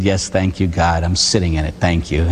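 dry, low sarcastic thanks; mock gratitude. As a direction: thank him through gritted patience. Sarcastic reply to God.

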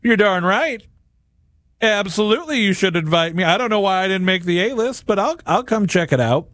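high, cocky mock guest; smug arrogance. Arrogant guest answering the servant's invitation, smug. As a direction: preen like the guest who thinks he earned it. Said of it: "Or is it".

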